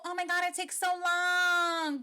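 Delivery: high, drawn-out mock whine; mocking complaint. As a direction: mimic an overdone complaint, stretching the words out. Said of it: "So this whole".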